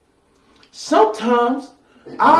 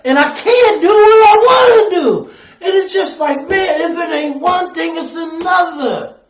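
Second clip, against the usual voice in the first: raised, high preacher's lament building in force; anguished frustration; 6 semitones higher, 5 dB louder than usual. Acted. Preacher voices a believer at wits' end complaining to the Lord, loud and exasperated. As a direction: cry out to God, climbing to a loud peak.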